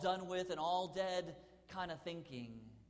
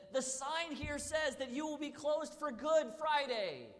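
higher, louder, slow indignant complaint; irate woman complaining to the bank teller; 10 semitones higher, 5 dB louder than usual. Retold or imitated. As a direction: spell out the grievance indignantly. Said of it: "the woman who was so irate".

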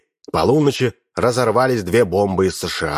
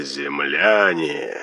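eldritch horror; 7 semitones lower, much slower than usual. A narrator's reading. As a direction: let it sink away into the distance. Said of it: "потусторонний голос".

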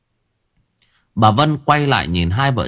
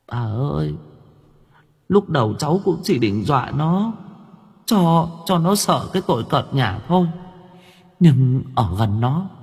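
put-on higher, tearful whimpering girl's voice; sobbing ghost girl; 7 semitones higher than usual. A narrator's reading. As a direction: whimper it out like a crying young ghost. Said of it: "Con Vong sụt sùi mếu máo". (giọng ma nữ mếu máo, cao hơn)

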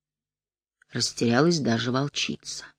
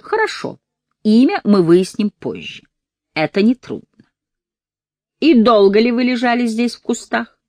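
cold interrogator; 8 semitones higher, 6 dB louder than usual. Acted. Interrogating the boy.

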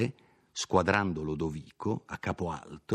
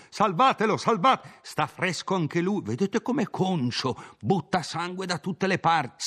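much higher, excited bystanders chattering over a killing; frantic onlookers crying out in shock; agitated crowd voices; 9 semitones higher than usual. Acted. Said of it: "nel frastuono confuso di quella folla".

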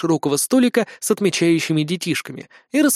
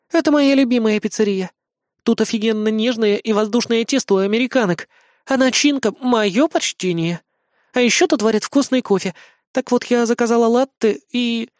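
put-on high, girlish gushing account; chatty idol girl; 7 semitones higher than usual. A narrator's reading. Idol girl explaining how she was found out, girlish chatter. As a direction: explain brightly in a high girl's voice.

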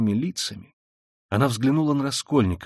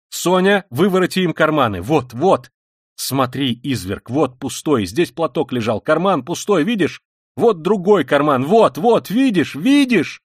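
triumphant, frantic Katerina Ivanovna; 3 semitones higher, 6 dB louder, slower than usual. Acted. Katerina Ivanovna turning out Sonya's pockets, frantic insistence. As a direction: insist they look, ever more forcefully.

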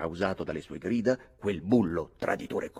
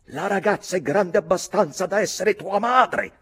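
high, loud contemptuous snarl; 13 semitones higher, 10 dB louder than usual. Acted. Mick snarling at Redmond, loud and contemptuous. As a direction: growl it at the lovesick boy. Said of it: "ringhiò Mick".

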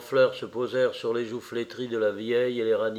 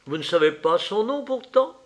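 sharp, louder and higher question; harsh; 7 semitones higher, 3 dB louder than usual. Acted. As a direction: ask it bitterly. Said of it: "dit-il âprement". (âprement, plus fort)

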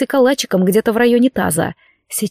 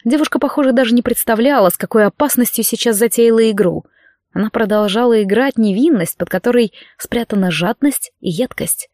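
ordinary delivery, nothing marked

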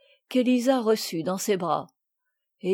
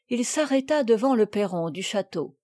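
usual speaking voice

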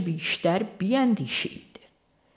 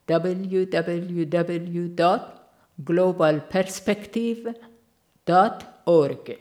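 web address spelled out very slowly, letter by letter; unhurried dictation of a URL; much slower than usual. Real, not acted. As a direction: read the address slowly so listeners can copy it.

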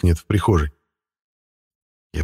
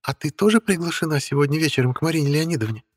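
high, sugary cartoon-princess chirp; 8 semitones higher, 5 dB quieter than usual. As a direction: chirp it sweetly, with a sting. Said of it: "прощебетала Воронецкая". (щебечущая принцесса)

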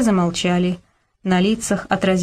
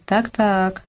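drawn-out, knowing relish; predatory smile. A narrator's reading. Borka watching the quarrel, with a predatory smile. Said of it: "хищно улыбался Борька".

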